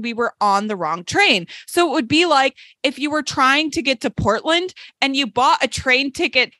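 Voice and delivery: ordinary talk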